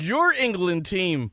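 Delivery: "Your" stressed high and slow. Real, not acted. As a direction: lean on "Your" teasingly, slowly. Host teasing English-born guest.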